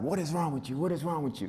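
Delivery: high-pitched mock exasperated demand; imitated scolding. Uncle to crying nephew he has pinned, mock scolding. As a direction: play the uncle confronting a child.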